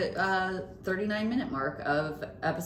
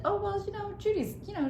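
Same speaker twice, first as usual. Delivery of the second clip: high-pitched, delicately put-on mom voice; imitating mother; faster than usual. Mother describing daughter to someone, imitated in high voice. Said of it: "I heard my mother describe me".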